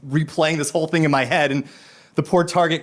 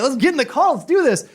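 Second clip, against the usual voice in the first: high, loud eager burst; eager yes; 12 semitones higher, 4 dB louder than usual. Retold or imitated. Dad to son asking for a bike, high and loud with eagerness. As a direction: jump at the chance, thrilled.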